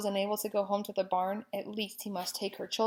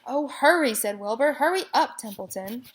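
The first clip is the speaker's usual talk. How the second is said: loud, high urging; urgent; 4 semitones higher, 6 dB louder than usual. A narrator's reading. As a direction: hurry him along. Wilbur urging.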